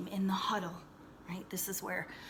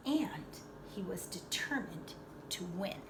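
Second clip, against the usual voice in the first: usual speaking voice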